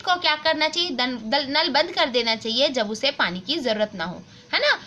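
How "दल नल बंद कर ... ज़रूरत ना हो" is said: ordinary talk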